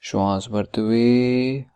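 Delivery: measured instructions with drawn-out endings and long pauses; guided meditation. Acted. Coach guiding listeners to tighten and relax muscles, guided meditation voice. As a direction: lead the body relaxation step by step, stretching the last syllables.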